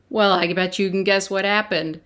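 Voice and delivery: ordinary delivery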